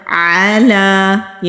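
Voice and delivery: علی very slow, ain voiced from the throat. Shown as a demonstration. Teacher recites علی slowly to show the ain. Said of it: "عین نہیں پڑھتے، ہمزہ پڑھ دیتے ہیں".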